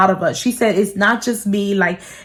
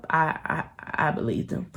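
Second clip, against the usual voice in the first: drops to a hushed confession; whispered aside; 6 semitones lower, 12 dB quieter than usual. Real, not acted. Telling viewers, whispered admission. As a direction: say it under your breath, embarrassed.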